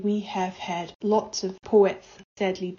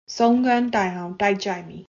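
poem title in Burmese; 4 dB louder than usual. Acted. Poet announcing her Burmese poem's title to listeners, deliberate.

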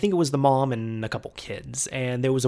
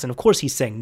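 ordinary delivery

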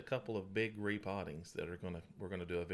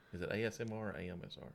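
normal talking voice

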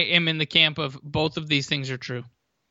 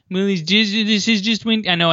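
higher, mock cautious naysayer; buzzkill fan voice; 5 semitones higher, 3 dB louder than usual. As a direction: imitate the wet-blanket fan. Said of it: "you don't wanna be that guy that's like the".

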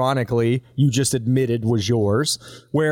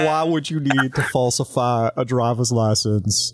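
My Cousin Vinny judge; 3 semitones lower than usual. Acted. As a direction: question him like a Southern judge. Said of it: "thinking of the My Cousin Vinny judge".

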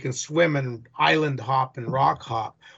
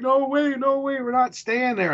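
high, panicky sister voice refusing; frightened sister; 11 semitones higher than usual. Retold or imitated. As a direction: squeal it like his scared sister.

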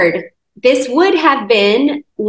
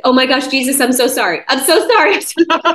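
stunned apology; 4 semitones higher, faster than usual. Retold or imitated. Horrified at her being stuck.

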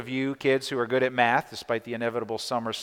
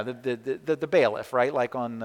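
ordinary talk, nothing marked

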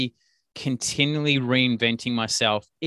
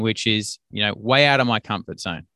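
usual speaking voice

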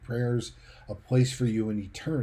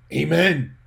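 raised, booming imitation of an exclamation; loud retold amen; 5 semitones higher, 8 dB louder than usual. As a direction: belt it out like dad's one outburst. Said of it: "He said loudly one morning".

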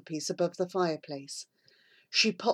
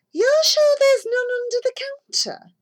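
much higher, louder pushy demand; demanding housewife; 7 dB louder than usual. A narrator's reading. Housewife pressing the shop assistant for marmalade, high pushy demand. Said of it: "she demanded".

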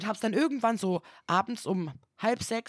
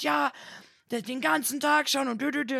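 higher, mocking shouted complaint; mock yelling stranger; 6 semitones higher than usual. A stranger still yelling about the parking spot, mocked. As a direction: parody his ranting. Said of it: "Der Typ schreit weiter rum".

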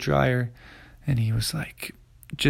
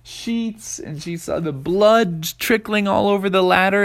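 plain reading voice

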